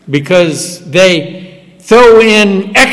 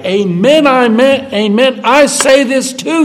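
higher, rising amen, amen building to I say this; solemn emphasis; 9 semitones higher than usual. Shown as a demonstration. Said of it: "that's a solemn thing".

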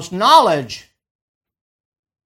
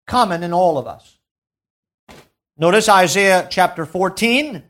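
usual speaking voice

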